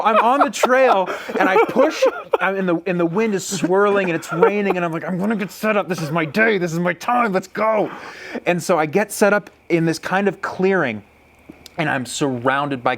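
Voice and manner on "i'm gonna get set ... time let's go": higher, keyed-up resolve; self pep talk. Retold or imitated. Hunter hyping himself in wind and rain, keyed-up pep talk. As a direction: psych yourself up, pitch lifted.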